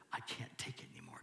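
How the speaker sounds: very soft, hurried whisper; whispered plea. Acted. People praying to God at their limit, whispered plea. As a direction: whisper it like someone worn out. Acted despairing prayer to God.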